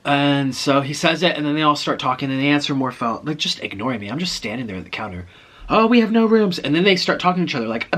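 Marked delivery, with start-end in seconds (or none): 5.7-6.6 loud, high retold refusal; mock exclamation. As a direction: imitate a clerk calling out a refusal. Clerks answering phones, ignoring her.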